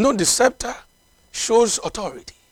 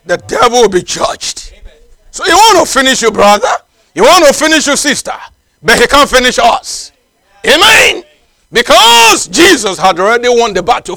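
fiery preaching; 2 semitones higher, 7 dB louder than usual. Acted. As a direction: preach it to the whole church at full force. Preacher exhorting the congregation.

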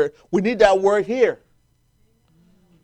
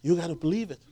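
much softer intimate repeat; softly; 3 semitones lower, 12 dB quieter, faster than usual. Acted. Preacher softly repeats the charge to believe. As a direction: pull back and say it gently.

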